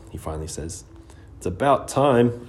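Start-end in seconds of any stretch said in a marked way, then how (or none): 1.4-2.5 teasing exclaim. A narrator's reading. Ari pops up from couch.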